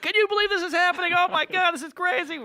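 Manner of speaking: put-on high, loud, racing teenage freak-out; giddy sixteen-year-olds. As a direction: squeal it like overexcited kids. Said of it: "sixteen-year-old kids like".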